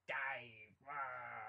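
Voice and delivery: mock roar, drawn out; lightly sketched bellowing thespian; imitating booming actor. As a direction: stretch it into a play roar. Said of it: "Yeah, he's like".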